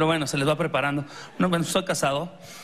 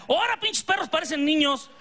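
mom yelling; 9 semitones higher than usual. Retold or imitated.

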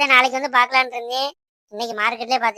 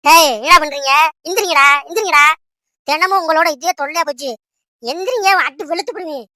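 raised, higher-pitched voice of an exasperated mother; angry scolding; 4 semitones higher, 5 dB louder than usual. Acted. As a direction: scold the children loudly to get up. Mother scolds children, threatens a beating.

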